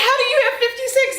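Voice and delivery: high, fast incredulous Ross; quoting Ross. Retold or imitated. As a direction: fire it off high and disbelieving. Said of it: "He's like".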